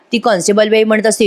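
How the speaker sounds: usual speaking voice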